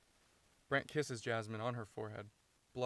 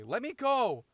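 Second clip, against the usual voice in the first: high, loud demand for release; struggling yell; 11 semitones higher, 11 dB louder, slower than usual. Acted. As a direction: yell it while fighting to break free. Acted struggle to break free.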